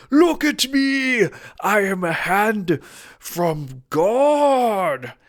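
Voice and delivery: very high, loud and slow, proclaiming; booming hand of God. Acted. Tarot reader voicing the card's divine hand, booming. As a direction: boom it out like a divine hand from the sky. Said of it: "a hand coming out of nowhere going".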